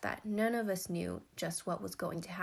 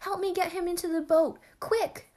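high, loud, frantic command; urgent cry; 10 semitones higher, 8 dB louder than usual. A narrator's reading. Half-sister gripping her stricken lover, frantic cry for help. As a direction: call for help in a panic. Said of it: "cried the ancient half-sister".

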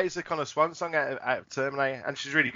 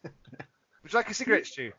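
high, slow teasing offer; lightly acted host setting up a gag; mock questioner; 3 semitones higher, slower than usual.

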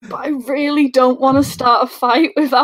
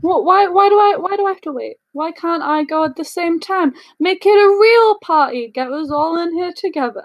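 higher pitched in-character protest; 6 semitones higher than usual. Acted. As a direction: whine about waiting in a raised voice. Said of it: "I don't know where my character voice went".

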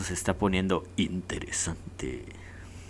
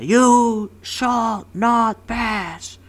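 hammed-up, higher, louder, very slow bellow; epic shout; 12 semitones higher, 7 dB louder, much slower than usual. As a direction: roar it like a wizard blocking the bridge. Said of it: "Y bien mamalón que le grita". (grito épico exagerado, muy lento y agudo)

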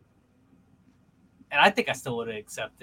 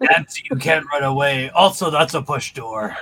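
Vecna; 7 dB louder than usual. Pretend. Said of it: "like, in a deep voice".

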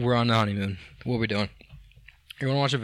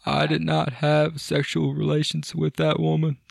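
hammed-up presidential denial, higher; Bill Clinton impression; 4 semitones higher than usual. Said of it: "if you just talk like this".

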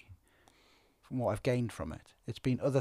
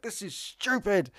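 irritated snap, slow and higher; snapping; 9 semitones higher, slower than usual. Retold or imitated. A man fumbling bags and a mask at a door snaps, slow irritated snap. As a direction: bite it off, fed up. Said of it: "just snapped me".

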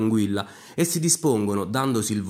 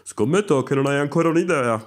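lightly voiced marshal placing a bet; old marshal's challenge, slightly heightened; 3 semitones higher, 3 dB louder than usual. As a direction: raise the voice a little as the marshal. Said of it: "disse il maresciallo".

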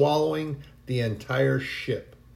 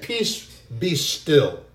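calm command; 4 dB louder, much slower than usual. Acted. Jesus speaking to the sea, slow calm command. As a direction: still the storm with each word.

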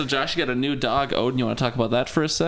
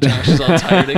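laughing; 10 dB louder than usual. Real, not acted. Laughing while teasing Josh.

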